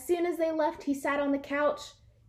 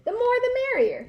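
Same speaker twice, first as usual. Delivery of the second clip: much higher, quick gleeful cry; cheerful welcome; 11 semitones higher, 4 dB louder, faster than usual. A narrator's reading. Dog host greets crowds of guests, high and gleeful. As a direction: throw the door wide happily. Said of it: "he said".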